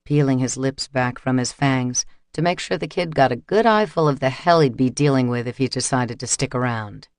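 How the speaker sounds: ordinary delivery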